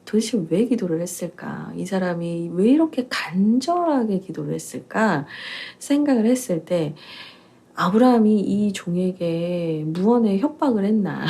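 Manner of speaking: ordinary talk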